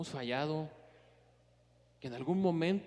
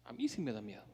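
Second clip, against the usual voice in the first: quiet confession; 4 semitones lower, 5 dB quieter, faster than usual. Real, not acted. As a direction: lower and softer, stressing "mí". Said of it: "Ah, a mí sí me da miedo". (confesión baja y suave, acento en "mí")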